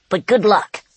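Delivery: squeaky little rat voice. Acted. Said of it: "squeaked the rat".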